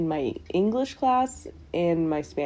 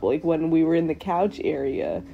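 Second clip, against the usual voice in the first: ordinary talk, nothing marked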